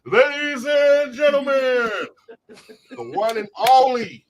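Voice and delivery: slow, loud, high hype announcer; fighting game announcer. Shown as a demonstration. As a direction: stretch every word like a ring announcer. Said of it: "turn on my announcer voice".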